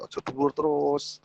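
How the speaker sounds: held high and drawn out; high pitch. Shown as a demonstration. Coach showing intonation that stays too high, high-pitched. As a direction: stay up high, stretching the words slowly. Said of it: "intonasi ini berarti nada suara".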